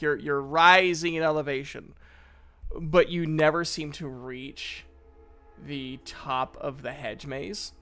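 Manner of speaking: usual speaking voice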